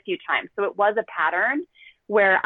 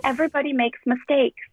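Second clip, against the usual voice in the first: higher-pitched, lightly imitated small boy; three-year-old's little voice; 6 semitones higher than usual. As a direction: reassure Mama as a little boy would. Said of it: "in his three-year-old little voice".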